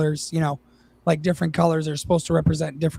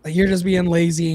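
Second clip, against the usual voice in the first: normal talking voice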